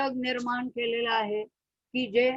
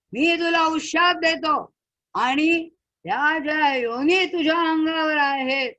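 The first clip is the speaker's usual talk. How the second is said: loud proclamation; 5 semitones higher, 6 dB louder than usual. Acted. Sage proclaims release from curse.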